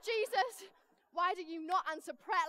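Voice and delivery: hammed-up exasperated shout; retold storming complaint. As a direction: protest at God in mock outrage. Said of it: "I was storming".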